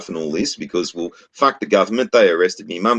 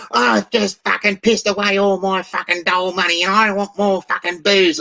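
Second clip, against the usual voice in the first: mock drunk claimant; 12 semitones higher than usual. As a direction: whine for more booze in a put-on voice. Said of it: "It's like".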